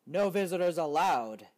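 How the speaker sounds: loud gruff bear bark; gruff bear telling the mouse off; grumpy bear. Acted. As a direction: bear barking at a visitor at the door. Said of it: "Bear said".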